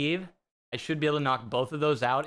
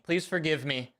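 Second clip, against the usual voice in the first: ordinary talk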